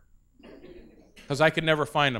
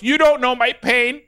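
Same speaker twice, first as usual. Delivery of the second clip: put-on toddler son; 7 semitones higher, 4 dB louder than usual. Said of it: "And then he goes".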